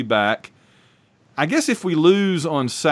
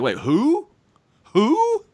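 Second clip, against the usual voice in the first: surprised; 12 semitones higher, much slower than usual. Retold or imitated. Retold disbelief at the news.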